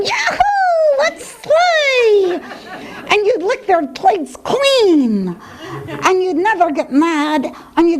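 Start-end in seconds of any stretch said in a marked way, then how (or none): 0.0-2.4 high, laughing excited yelp; imitating an overjoyed dog; happy dog. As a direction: bounce like a dog greeting family. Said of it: "you'd be at the door going".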